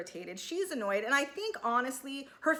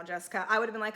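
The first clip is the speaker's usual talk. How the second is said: usual speaking voice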